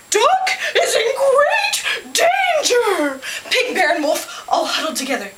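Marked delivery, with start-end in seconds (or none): 0.1-3.2 very high, drawn-out warning; hammed-up storybook alarm; dramatic alarm. A narrator's reading. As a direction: cry out a dire warning slowly. Owl announces dire danger.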